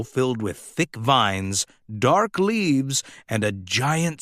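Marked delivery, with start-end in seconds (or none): none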